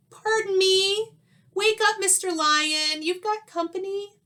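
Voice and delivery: high, polite little squeaky voice; timid mouse voice. Acted. Mouse politely waking the sleeping lion, high and timid. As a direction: nervously wake a sleeping giant.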